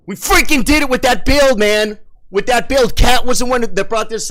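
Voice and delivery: high, loud burst of triumph; real overjoyed yelling; elated shouting. Real, not acted. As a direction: crow about the win at the top of your voice. Still celebrating the win.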